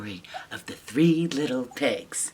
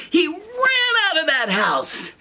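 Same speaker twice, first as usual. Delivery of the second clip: excited storytelling; 12 semitones higher than usual. A narrator's reading. Storyteller to kids, excited climax. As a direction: tell it with big excitement.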